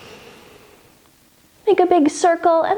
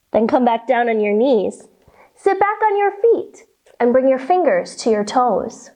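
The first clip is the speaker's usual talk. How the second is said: ordinary talk, nothing marked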